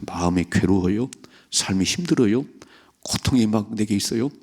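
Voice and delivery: normal talking voice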